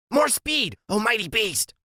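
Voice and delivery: high, grand urging cry; Freak's high voice. A narrator's reading. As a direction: spur him on like a mighty steed.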